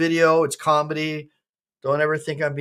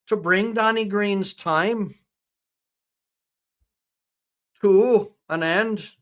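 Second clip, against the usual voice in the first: put-on slow, higher Irish-accented verse; attempted Irish accent; 4 semitones higher, slower than usual. Reciting a ballad for Danny Green, attempted Irish accent. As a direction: draw the line out in an amateur Irish brogue. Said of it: "I'm trying to talk Irish".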